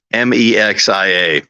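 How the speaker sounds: ordinary talk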